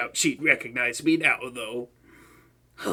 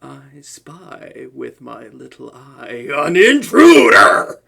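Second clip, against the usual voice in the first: louder and slow, savouring each word; gleeful villain call-out; 3 semitones lower, 10 dB louder, slower than usual. Acted. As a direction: announce the catch like a game. Villain springs on the intruder.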